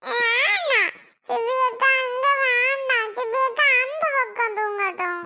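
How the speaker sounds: put-on high childish voice, whiny refusal; a small boy pushing his sister away; little boy voice. Little boy whining that sister sleep elsewhere, high.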